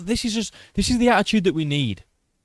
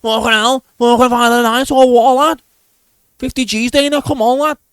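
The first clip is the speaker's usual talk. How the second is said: loud, high, hammed-up Scouse shouting, building up; mock Paddy Pimblett; 8 semitones higher, 7 dB louder than usual. A Liverpool fighter gloating after his win, loud mock Scouse. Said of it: "was like".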